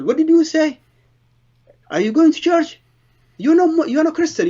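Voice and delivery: bradando muito agudo, indignado e em choque; indignant outburst. Retold or imitated. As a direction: gritar com a mulher, furioso e incrédulo. Shocked at children going to church.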